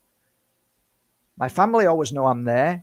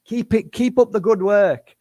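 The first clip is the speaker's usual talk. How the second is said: higher and louder bright pep-talk; cheery encouragement; 6 semitones higher, 5 dB louder than usual. Shown as a demonstration. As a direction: cheer the viewer on like a coach. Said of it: "That's my voice".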